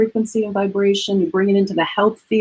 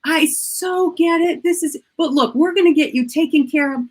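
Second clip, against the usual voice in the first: sympathetic clerk; 9 semitones higher than usual. Retold or imitated. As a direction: soothe her, drawing out the first words. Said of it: "She's like".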